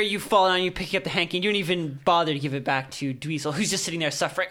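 ordinary talk, nothing marked

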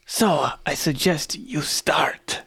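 slow, unvoiced menace; menacing whisper. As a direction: whisper the ultimatum slowly. Interrogation threat to captive.

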